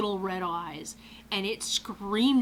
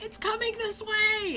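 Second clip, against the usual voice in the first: very high panicked squeal; freaking out; 13 semitones higher than usual. Retold or imitated. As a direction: re-enact a frantic warning to a teammate. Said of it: "I was freaking out".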